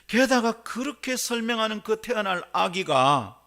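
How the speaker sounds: loud, high, excited sermon delivery; fired-up preaching. Acted. Preaching at full force.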